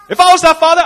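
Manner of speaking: ordinary delivery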